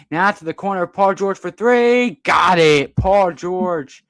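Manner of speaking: play-by-play burst as the shot drops; excited basketball call. Real, not acted. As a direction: celebrate the made three out loud. Calling a made three-pointer.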